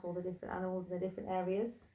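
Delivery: ordinary delivery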